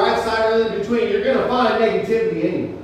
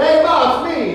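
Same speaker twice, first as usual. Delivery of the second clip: higher-pitched imitated Italian-American son; East Coast Italian accent; 9 semitones higher, 5 dB louder than usual. Army buddy phoning his mother, imitated East Coast Italian accent. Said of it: "he had that Eastern Italian accent".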